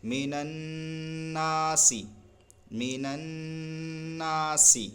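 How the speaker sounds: من الناسی recited slowly with the a of الناسی held long; slow tajweed demo; stretched alif. Shown as a demonstration. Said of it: "کھینچ کر پڑھیں گے".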